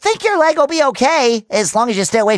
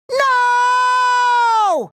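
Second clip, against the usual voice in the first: long, high drawn-out cartoon wail; high-pitched anguished shout; dismayed cry; 11 semitones higher than usual. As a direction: cry out in dramatic dismay, holding the vowel. Cries out in dismay at mishap.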